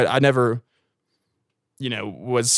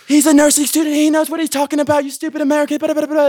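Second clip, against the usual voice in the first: much higher, louder, squeaky mock French girl scolding; 6 dB louder than usual. French girls telling off the stupid American, in a high mocking voice. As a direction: parrot the girls scolding him in a shrill put-on voice. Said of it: "they're like".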